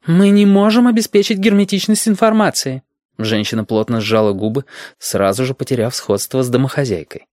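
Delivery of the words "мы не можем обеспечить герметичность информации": very high, insistent woman's voice; objecting woman. A narrator's reading. Woman objects in the meeting, high and insistent.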